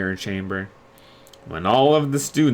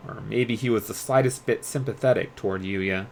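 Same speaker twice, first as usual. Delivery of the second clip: ordinary delivery, nothing marked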